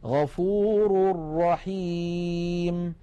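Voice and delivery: غفور رحيم chanted very slowly as "ghafurur-rahim", the tanween swallowed into a doubled ra; slow Quranic recitation. Shown as a demonstration. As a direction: melodic tajweed recitation. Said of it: "ومثال التنوين عند الراء".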